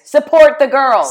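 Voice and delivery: loud, high rallying cry for the slogan; excited cheer. Acted. Shouts the shirt slogan again.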